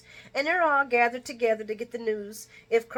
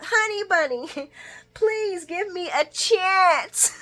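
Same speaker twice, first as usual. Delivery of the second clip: high, slow pleading with a laugh; mock begging coo; 10 semitones higher, 5 dB louder, slower than usual. [name]'s voicemail begging Martin, high, slow and laughing. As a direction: drag out the begging sweetly.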